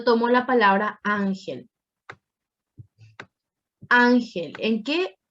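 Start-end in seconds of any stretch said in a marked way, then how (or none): 1.0-1.7 "ángel" with stress on the first syllable. Shown as a demonstration. Teacher gives students an example word, stressing the start of "ángel". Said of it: "en la primera sílaba". 3.9-4.5 "Ángel" with a loaded first syllable, then falling; stress on first syllable. Shown as a demonstration. Teacher asks class where the voice falls, stressing "Án" in "Ángel". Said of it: "en la primera sílaba".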